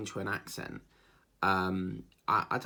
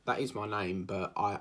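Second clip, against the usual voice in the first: normal talking voice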